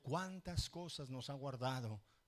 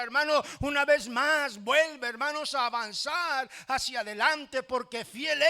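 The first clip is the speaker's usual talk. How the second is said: lifting the voice in fervent preaching; rising preaching; 9 semitones higher, 8 dB louder than usual. Acted. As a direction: preach it with growing conviction, somewhat louder. Pastor preaching, voice raised. (predicando con fervor creciente)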